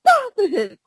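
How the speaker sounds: squeaky high no, stop it; high-pitched protest. Retold or imitated. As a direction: pitch it way up, pleading. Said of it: "I'm like".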